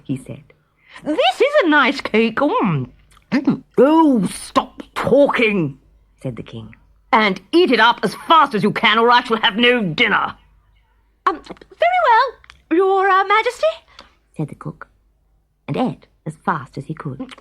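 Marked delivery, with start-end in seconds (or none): 1.0-3.6 happy munching cook. Acted. Cook enjoying cake. 3.8-5.8 impatient king. Acted. 7.1-10.4 impatient king. Acted. King orders cook. 11.3-13.7 hesitant cook. Acted.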